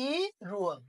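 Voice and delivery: slow, drawn-out ইরুয়াল with R; linked pronunciation. Shown as a demonstration. Teacher sums up for students, slow linked ইরুয়াল. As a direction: stretch ই, then glide into রুয়াল. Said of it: "তখন উচ্চারণ হবে রুয়াল".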